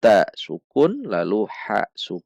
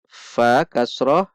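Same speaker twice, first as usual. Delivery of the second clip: normal talking voice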